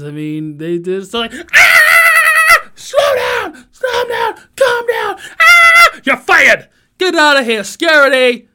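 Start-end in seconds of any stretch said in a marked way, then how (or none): 1.5-6.0 high-pitched mock screaming fit; frantic screaming. Acting out a workplace argument, frantic high screaming. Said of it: "this is how people get fired". 6.0-8.5 loud, raised dismissal; angry boss. Acted. Boss firing a screaming employee, loud and angry.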